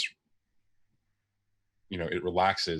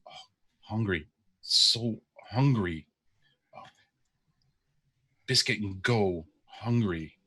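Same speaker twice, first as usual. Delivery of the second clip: podling mumbling. Acted. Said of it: "the podling mumbling under his breath".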